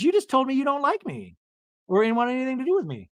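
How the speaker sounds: high-pitched aggrieved retelling; mock protest. Castmate protesting to her rival, high mock indignation. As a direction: act out a wounded protest, pitched well up. Acted quarrel, [name] protesting to Mary.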